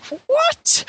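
high, squeaky stunned "What?"; shocked what. Retold or imitated. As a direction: blurt it out in total disbelief. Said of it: "James is like".

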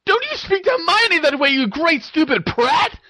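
very high furious yell; screamed. A narrator's reading. Ginny defends Hermione against her brother, screaming. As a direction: scream the insult, drawing out the end. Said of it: "screamed Ginny".

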